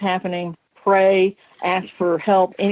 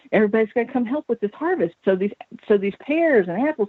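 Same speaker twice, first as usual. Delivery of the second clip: ordinary delivery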